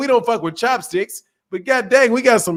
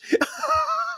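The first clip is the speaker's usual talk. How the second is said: high squeal laugh; 8 dB quieter than usual. Real, not acted. Big laugh.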